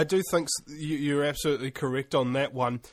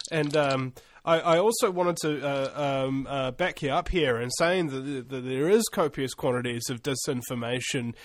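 ordinary delivery

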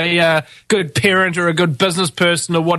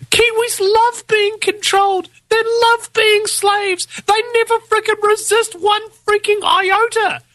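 mocking high voice; 13 semitones higher than usual. Mocking rebuttal of quoted politician.

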